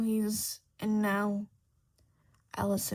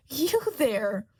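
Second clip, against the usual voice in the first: loud imperious shout; queen shouting at Griffin; 4 semitones higher, 7 dB louder than usual. A narrator's reading. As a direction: bark the command. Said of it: "the queen shouts".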